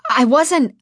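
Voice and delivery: high, loud, quick objection; protesting. A narrator's reading. Scolded girl begins to defend herself, protesting. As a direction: start to object in self-defence.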